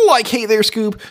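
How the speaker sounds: high-pitched cartoon slacker impression; Shaggy. Shown as a demonstration. Man doing his classic cartoon impression, high-pitched. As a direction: pitch it way up like a goofy cartoon pal. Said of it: "I got my Shaggy".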